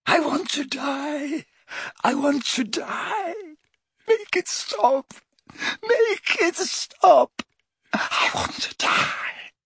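very high, slow crying, sinking to a whisper; anguished crying. A narrator's reading. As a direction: cry out wanting it over. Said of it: "began to scream in more anguish".

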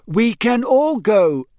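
slow, high, loud hint; drawn-out, meaningful emphasis; pointed. A narrator's reading. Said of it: "said Hermione pointedly".

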